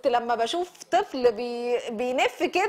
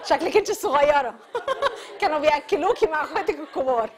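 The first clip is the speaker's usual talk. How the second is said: laughing; 2 semitones higher than usual. Real, not acted. Teasing a student while laughing.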